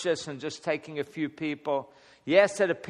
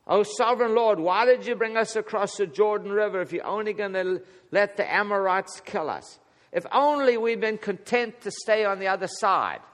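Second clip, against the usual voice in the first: moaning complaint; 6 semitones higher, 3 dB louder than usual. Retold or imitated. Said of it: "he moans and groans".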